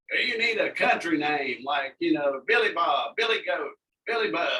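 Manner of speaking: loud, upbeat suggestion; excited DJ voice. Retold or imitated. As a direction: pitch it up with enthusiasm. Retold morning DJ suggestion.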